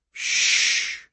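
a long, soothing shh; indulgent hush. A narrator's reading. Rainbird shushing the struggling doctor, indulgent hush. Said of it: "He spoke with the pleased indulgence of a mother".

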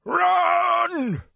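high, loud anguished scream; screamed cry. A narrator's reading. Tom fighting the Fade, screaming to the boys. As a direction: scream the word in pain. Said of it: "in the middle of the scream".

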